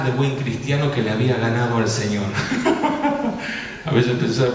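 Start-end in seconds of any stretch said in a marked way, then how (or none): 2.3-3.8 high chuckle; short burst of amused laughter; laughing. Real, not acted. Preacher amused at his own pious serenity, laughing.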